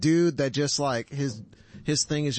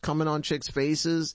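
usual speaking voice

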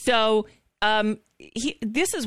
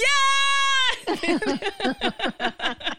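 high laugh. Real, not acted. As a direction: burst out laughing. Laughing at the crowd.

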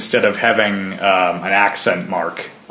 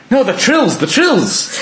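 Irish accent imitation; 12 semitones higher than usual. Irish kid correcting the listener, mock Irish accent. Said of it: "And he's like".

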